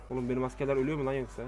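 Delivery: ordinary talk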